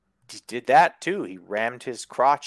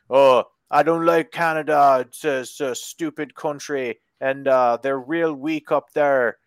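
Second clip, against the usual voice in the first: mocking, badly faked English accent; hammed-up attempt at an English accent; 3 dB louder than usual. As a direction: imitate a sneering wrestler in a poor English accent. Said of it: "I can't do an English one".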